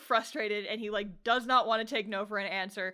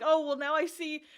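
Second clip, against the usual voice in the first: put-on higher-pitched, stammering flustered boy; 7 semitones higher, 3 dB louder than usual. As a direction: play him tripping over his words, hurt and confused. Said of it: "so flustered".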